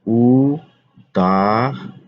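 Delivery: উদাহরণ stretched very slowly with a rising end; drawn-out spelling. Shown as a demonstration. Teacher to students, slowly stretching উদাহরণ. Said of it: "teacher stretches word for students".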